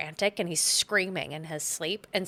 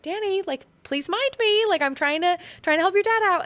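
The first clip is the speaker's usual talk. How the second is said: much higher, louder pleading mom; pleading Wendy; 13 semitones higher, 5 dB louder than usual. Retold or imitated. Wendy begging Danny to mind her, higher pleading. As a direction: beg the child to mind you. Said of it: "she's like".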